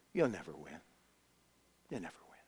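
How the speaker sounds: very low, dying to near silence; barely voiced, deep and soft; hushed taunt. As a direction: let the discouraging words sink away. Giant's taunt, fading echo.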